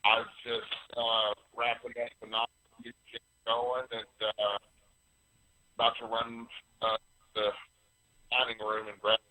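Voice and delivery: choppy phone line with echo; breaking up on phone. Real, not acted. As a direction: voice cutting in and out on a call. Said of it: "you are breaking up, and I hear an echo".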